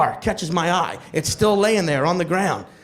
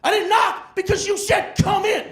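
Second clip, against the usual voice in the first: high, heated comeback; angry retort; 11 semitones higher than usual. Acted. Delivery guy answers the tenant's knocking complaint, angry retort. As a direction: throw the blame straight back, angrily. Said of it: "angrily goes".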